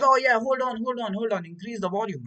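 gleeful imitation; mock eager friend. Masochist friend enjoying it.

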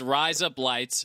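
"Rise Up Lights" slowly, word by word; slow demonstration. Shown as a demonstration. Host teaching an Australian accent trick, slow demonstration. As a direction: spell out "Rise Up Lights" at half speed. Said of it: "but you say it fast".

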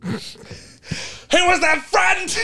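very high, drawn-out mock-anguished scream; screamed outcry. Mimicking Harry's crying scene for laughs, hammed-up scream. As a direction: scream it over the top, stretching the words. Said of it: "Like, screams it".

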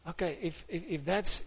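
normal talking voice

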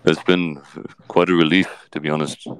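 Johnny Depp. Acted. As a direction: answer low with thoughtful pauses. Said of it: "Johnny Depp is on the show".

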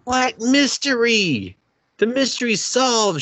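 high, drawn-out incredulous retort; exasperated, high-pitched rebuttal; exasperated disbelief. Real, not acted. Exasperated rebuttal to co-host's mystery remark.

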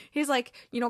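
ordinary talk, nothing marked

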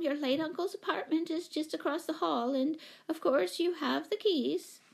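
high-pitched, fussy little lady; steady chatter. A narrator's reading. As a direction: prattle on in a high voice. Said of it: "talking steadily at Mother".

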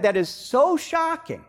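ordinary talk, nothing marked

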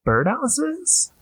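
high incredulous rising question; high, stunned disbelief; baffled disbelief. Retold or imitated. Finds birdhouses instead of bombs.

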